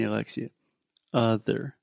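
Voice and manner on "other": "Other" with relaxed schwa uh vowel; schwa sound. Shown as a demonstration. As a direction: say "Other" with a short neutral uh. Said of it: "it should be other".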